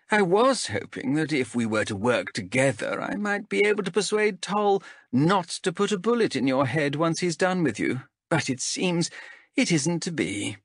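lightly voiced stern elf captain; a hint of a cool interrogator. Zenthi voiced by narrator.

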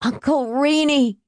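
high, urgent plea; desperate high appeal; pleading. A narrator's reading. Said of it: "he pleaded".

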